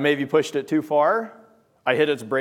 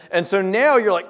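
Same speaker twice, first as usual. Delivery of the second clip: normal talking voice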